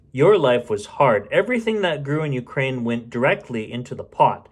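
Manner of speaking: ordinary delivery, nothing marked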